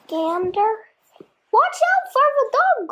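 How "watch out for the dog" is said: high warning sheep; sheep crying. A narrator's reading. Sheep warning Wilbur, high call. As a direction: cry out a warning. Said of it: "cried the sheep".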